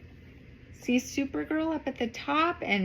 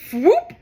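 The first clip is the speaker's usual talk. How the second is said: loud whoop; 9 semitones higher, 12 dB louder than usual. Acted. Jump sound effect.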